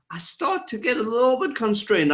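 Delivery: exaggerated constrained tone. Shown as a demonstration. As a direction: pitch jumps high, as if stressed by tough questions. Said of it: "I know I'm exaggerating now".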